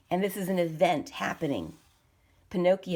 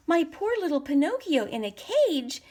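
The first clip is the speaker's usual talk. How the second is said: put-on dismayed old man; 9 semitones higher, 4 dB louder than usual. A narrator's reading. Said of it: "said Geppetto".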